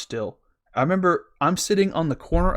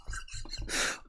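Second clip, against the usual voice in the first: soft, wheezy laugh; real helpless laugh; 14 dB quieter than usual. Real, not acted. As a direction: laugh until the sound nearly gives out. Cracking up at his own story.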